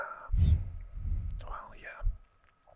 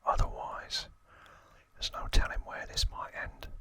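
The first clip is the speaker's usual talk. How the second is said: whispered Cockney gangster voice; Cockney accent. Acted. Albert Crisp warning a newcomer to London, whispered Cockney accent. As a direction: put on your best Cockney, all in a whisper. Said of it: "That's my best Cockney accent".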